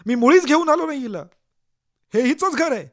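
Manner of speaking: very loud, high angry outburst; shouting. Acted. Accused man shouting at the lying woman, furious. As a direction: bellow that she is lying. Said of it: "तुम्ही ओरडता".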